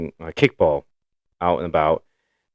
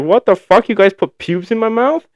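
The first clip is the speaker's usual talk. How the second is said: crying; 14 semitones higher, 6 dB louder than usual. Retold or imitated. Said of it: "I just started crying 'cause I was like".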